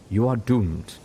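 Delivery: lightly voiced ominous pronouncement; intoned doom. A narrator's reading. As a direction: intone it like a prophecy. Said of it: "Zenith leaned close and intoned".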